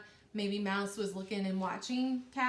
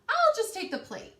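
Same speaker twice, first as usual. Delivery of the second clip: high, louder little mouse voice; greedy mouse voice; 11 semitones higher, 8 dB louder than usual. Acted. Teacher voicing the greedy mouse, high and eager. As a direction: squeal it as the mouse gets greedy.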